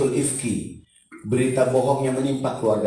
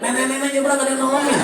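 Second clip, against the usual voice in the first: mock complaint; 12 semitones higher, 3 dB louder, faster than usual. Mocking nobody helps the grandma.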